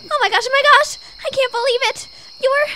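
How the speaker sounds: high, loud gushing; breathless excitement at meeting her hero; fangirl squeal. Acted. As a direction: gush like a starstruck fan. Fangirling over the rescuer.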